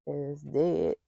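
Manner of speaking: ordinary talk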